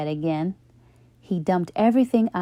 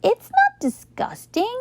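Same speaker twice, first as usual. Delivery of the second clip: much higher, put-on girl voice, pressing NOT; Carla protesting. A narrator's reading. Carla defending her lunch to Leslie, high protest. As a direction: insist it's fine.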